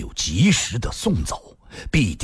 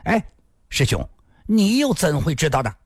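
hasty raised question; quick interjection, then a higher question; cutting in; 7 semitones higher than usual. A narrator's reading. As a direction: jump in to challenge him. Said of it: "新建抢地说道".

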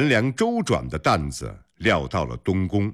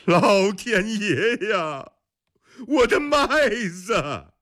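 high, drawn-out wail of despair; wailing cry; 13 semitones higher, slower than usual. A narrator's reading. Someone across the street crying out over ruined wheat, wailing. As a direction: cry out to the heavens, slow and high. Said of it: "有人哭喊了一声".

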